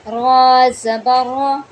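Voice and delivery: slow, loud रा said full and heavy. Shown as a demonstration. Teacher shows the heavy ra for students, slow and loud. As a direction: make the रा thick and full-mouthed. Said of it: "तब हम इसको मोटा पढ़ेंगे".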